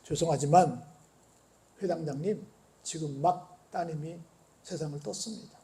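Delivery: 낮고 아주 조용한 전언; 조심스럽게 나직이 슬픈 소식을 전하듯; 비보를 전하는 사람. Retold or imitated. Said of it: "그 사람이 뭐라고 말하냐면". (낮은 목소리로 조심스레 전하는 비보)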